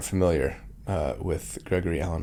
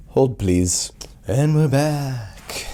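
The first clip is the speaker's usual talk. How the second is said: mock host announcement; 5 semitones higher, 5 dB louder, much slower than usual.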